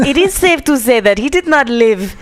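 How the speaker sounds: put-on light Russian accent; hint of an Eastern European accent. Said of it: "I had my Russian accent earlier".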